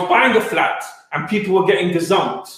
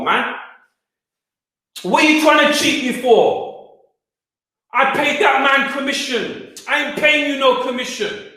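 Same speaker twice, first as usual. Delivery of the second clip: rising, heated, higher-pitched confrontation; angry retort; 6 semitones higher than usual. Retold or imitated. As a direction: tell him off, getting higher and hotter. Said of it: "I was angry".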